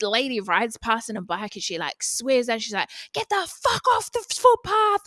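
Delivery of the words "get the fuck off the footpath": high, loud mock yell from a cyclist; imitating a yell. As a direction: yell it like a rude cyclist speeding by. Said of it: "She's, like, yelling at us".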